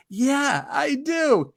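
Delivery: high, drawn-out eager yes; eager reply. Retold or imitated.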